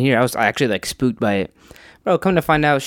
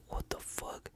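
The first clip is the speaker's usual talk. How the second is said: unvoiced hushed what the fuck; scared whisper at a strange voice; whispered shock; 24 dB quieter, slower than usual. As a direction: breathe it out in a stunned whisper.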